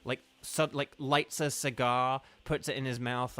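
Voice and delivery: usual speaking voice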